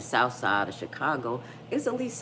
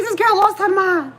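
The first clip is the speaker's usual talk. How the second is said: high, loud put-on daughter voice; daughter's outraged voice; 13 dB louder than usual. Daughter telling mom about her friend, outraged high voice. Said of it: "She's like".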